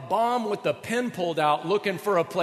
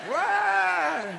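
mock wail; 13 semitones higher, 3 dB louder than usual. Imitating a wife's rant.

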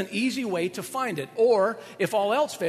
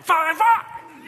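escalating fine; 10 semitones higher, 8 dB louder than usual. Retold or imitated. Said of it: "and then you say".

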